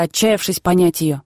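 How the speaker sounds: plain reading voice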